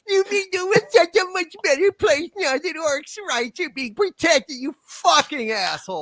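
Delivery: high, squeezed mock gushing; sneering squeal of mock delight; heavy sarcasm. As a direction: coo it with seething contempt. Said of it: "like, being so sarcastic".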